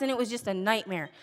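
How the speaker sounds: ordinary talk, nothing marked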